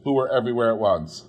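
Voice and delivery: plain reading voice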